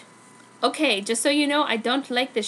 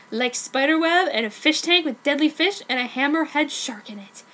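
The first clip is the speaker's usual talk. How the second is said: usual speaking voice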